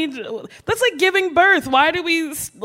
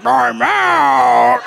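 deep voice; 3 semitones lower, 4 dB louder than usual. Retold or imitated. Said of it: "Deeper would be funnier".

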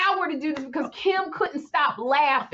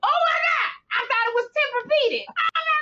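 much higher, shouting through giggles; hysterical shriek; 14 semitones higher than usual. Retold or imitated. Imitating Kim misreading therapeutic, shrieking. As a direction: shriek it like you can't hold it together. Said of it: "And then".

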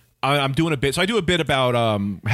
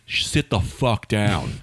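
low, slow, drawn-out order; stranger's command; 7 semitones lower, slower than usual. Retold or imitated. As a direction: lightly imitate a stranger ordering someone to sit. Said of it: "Some other guy goes".

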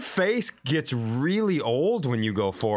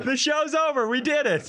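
mock triumph; 13 semitones higher than usual.

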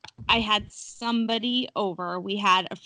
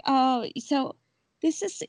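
high, uneasy polite chirp; high tense small talk; nervous guest; 8 semitones higher than usual. Retold or imitated. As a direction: force a compliment while scared. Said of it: "she's like".

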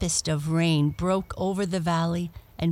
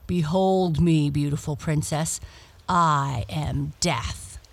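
grim reaper voice. Acted. Death reveals himself.

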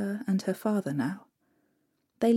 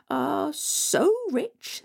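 slow, gloating drawn-out voice; sly stepmother; 7 semitones higher, much slower than usual. Acted. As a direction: savour the words slyly. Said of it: "said the stepmother".

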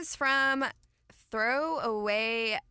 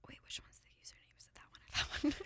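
whispered aside; hushed question off mic; 5 semitones higher, 10 dB quieter than usual. Real, not acted. Asking aside while reading aloud.

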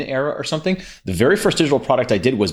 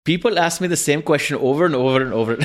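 higher, put-on Indian accent; mock-guru delivery in an Indian accent; 4 semitones higher than usual. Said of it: "can you say it in a guru voice".

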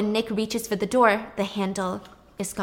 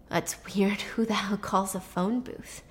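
whispered thought; 5 dB quieter than usual. Retold or imitated. Character's muttered thought.

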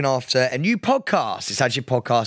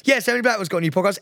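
fast, higher-pitched dismissive read; rushed radio mention; 6 semitones higher, much faster than usual. Retold or imitated. Radio host skimming a rival's podcast, rushed and dismissive. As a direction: race through it to get it over with. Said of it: "I literally went".

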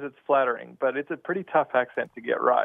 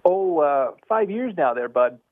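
put-on Canadian accent, a bit higher; 4 semitones higher, slower than usual. Shown as a demonstration. Australian caller shows off his best Canadian for the hosts, Canadian accent. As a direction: play up the Canadian lilt. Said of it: "Do your best".

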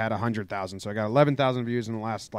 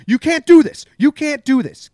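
much higher, much louder furious manager's yell; imitated shout; 11 semitones higher, 9 dB louder than usual. Manager screaming at the umpire, imitated furious shout. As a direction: scream it at the umpire, fading a little. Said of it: "Hinch just goes".

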